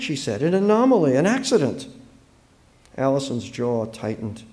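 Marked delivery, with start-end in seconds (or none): 0.4-1.9 lightly voiced girl, higher, insistent; pleading young girl. A narrator's reading. Girl excusing her dog's attack, mildly pleading. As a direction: offer it eagerly in a girl's higher voice.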